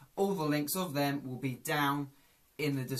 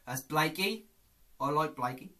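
ordinary talk, nothing marked

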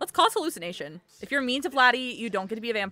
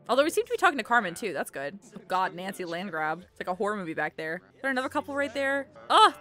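usual speaking voice

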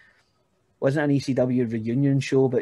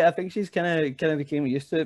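ordinary talk, nothing marked

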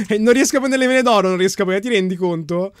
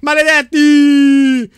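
long, loud, slow curse at the thieves; drawn-out curse; 5 semitones higher, 9 dB louder, much slower than usual. Real, not acted. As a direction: stretch the curse out loud like a mock lament. Cursing thieves who stole his helmet. (maledizione urlata e strascicata)

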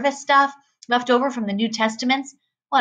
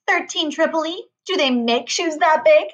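high, mocking shop girl; smirking disbelief; 6 semitones higher than usual. A narrator's reading. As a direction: sneer at the big shoe size. Smirking shoe store girl.

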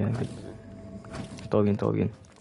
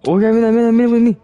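loud, higher excited call; excited shouting; 8 semitones higher, 8 dB louder, faster than usual. Real, not acted. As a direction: get the team's attention fast. Excited call mid-game.

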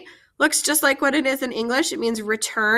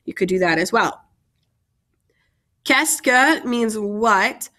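ordinary delivery

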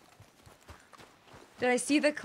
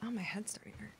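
whispered complaint; 12 dB quieter, faster than usual. Real, not acted. Quiet complaint while hunting.

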